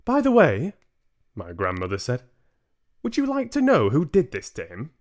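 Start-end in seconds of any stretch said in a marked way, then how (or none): 0.1-0.7 put-on high, loud, teasing old lady voice; a grandmother about to make mischief; sly grandmother. Grandmother baiting Mister Jenkins, high mischievous voice. 3.0-4.9 put-on high, loud, teasing old lady voice; a grandmother about to make mischief; sly grandmother. Grandmother baiting Mister Jenkins, high mischievous voice.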